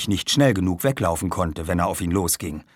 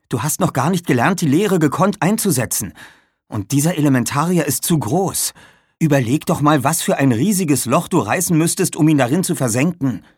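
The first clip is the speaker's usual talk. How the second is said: higher, louder reproach; urgent scolding; 7 semitones higher, 5 dB louder than usual. Acted. Tamara warns Aaron the creature is too big, urgent scolding. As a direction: talk them out of it emphatically. Girl urgently argues him out of it.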